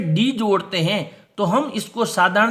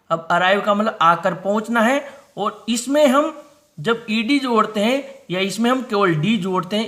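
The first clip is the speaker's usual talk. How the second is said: ordinary delivery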